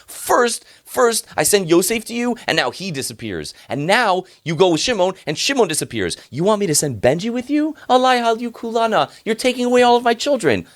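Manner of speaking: high, loud, furious outburst at his sons; a father who has lost too much lashes out. Acted. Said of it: "Yaakov gets furious and says".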